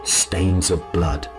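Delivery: usual speaking voice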